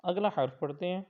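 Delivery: ordinary talk, nothing marked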